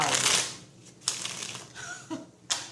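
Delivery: breathy high laugh; high airy laugh. Real, not acted. Reader laughing off her own aside. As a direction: laugh off the cheeky remark.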